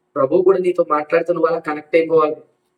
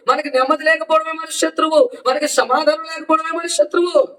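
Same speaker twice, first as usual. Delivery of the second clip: fervent preaching; 9 semitones higher than usual. Acted. Pastor urging the congregation about their enemies, impassioned preaching. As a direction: preach it out hard, pitched high.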